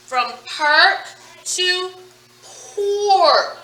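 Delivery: slow, drawn-out perk to pork; slow modelling read. Shown as a demonstration. Teacher modelling word chain for class, slowly. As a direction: stretch each sound so kids hear it.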